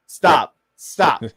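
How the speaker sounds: shouted stop, falling; loud stop. Real, not acted. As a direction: yell stop at the screen. Reacting to clip, cutting it off.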